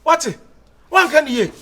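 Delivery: loud, high-pitched demand; furious shouting. Acted. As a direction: yell it at them, full force. Furious shouting at the quarrel's peak.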